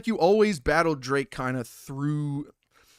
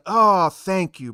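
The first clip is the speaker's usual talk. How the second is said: normal talking voice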